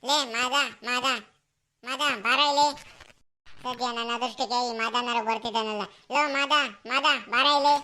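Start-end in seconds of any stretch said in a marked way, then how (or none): 0.1-3.0 calling out. Acted. Calling friend from afar. 6.1-7.8 shouting out. Acted. Calling friend again louder.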